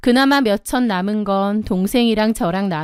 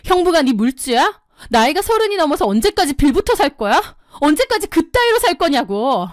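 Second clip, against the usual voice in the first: higher, heated scolding that builds; angry confrontation; 5 semitones higher than usual. Acted. Older sister phoning to confront her sibling, angrily scolding. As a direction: tear into her, voice rising as you go. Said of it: "동생에게 전화를 걸어 따졌습니다".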